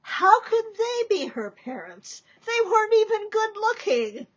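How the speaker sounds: ordinary delivery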